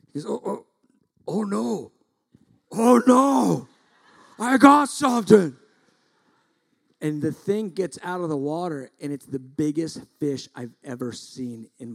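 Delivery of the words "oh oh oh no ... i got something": alarmed excitement bursting into a yell; mounting excitement. Retold or imitated. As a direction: start high, build to a loud shout. Acts out son hooking big fish.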